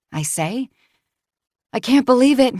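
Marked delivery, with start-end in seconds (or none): 1.7-2.6 breathless, higher and louder; big exhale. A narrator's reading. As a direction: gasp it out, winded and amazed. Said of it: "she says with a big exhale".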